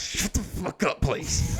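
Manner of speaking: slow, voiceless plea through a laugh; hushed scolding. Retold or imitated. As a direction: whisper it like a mortified parent. Dad hushing daughter in public.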